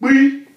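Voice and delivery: P as high "kpee"; Yoruba P. Shown as a demonstration. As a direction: pop P as a high "kp" plus "ee". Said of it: "Remember the pronunciation".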